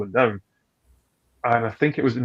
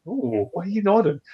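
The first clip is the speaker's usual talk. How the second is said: mock puzzled; 9 semitones higher than usual. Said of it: "you're going".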